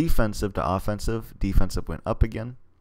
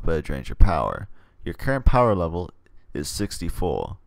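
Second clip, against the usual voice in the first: normal talking voice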